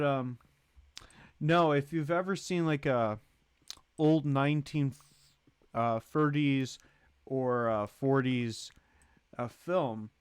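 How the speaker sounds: ordinary talk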